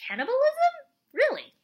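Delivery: high-pitched, disbelieving questions; can't believe it, voice shooting up; incredulous. Retold or imitated. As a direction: pitch leaps up in appalled disbelief. Reacting in disbelief to the plot.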